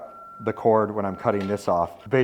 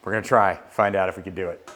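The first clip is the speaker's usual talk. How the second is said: ordinary delivery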